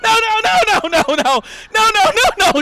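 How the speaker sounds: loud, rising panicked no's; mock horror, chanting no over and over; horrified Get Out denial.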